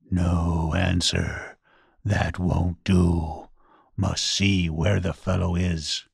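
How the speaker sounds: low, slow grumble; grumbling ghost voice. A narrator's reading. Ghost finding no answer from the blind man, low grumbling voice. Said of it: "the voice grumbled".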